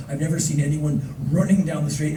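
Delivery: ordinary talk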